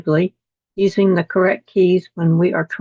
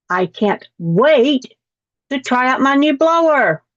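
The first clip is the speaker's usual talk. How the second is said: "I can't wait" slow, then "blower" pitched up high; excited read; 5 semitones higher than usual. Shown as a demonstration. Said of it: "Is that more interesting?".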